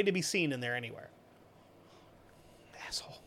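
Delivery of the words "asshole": ordinary delivery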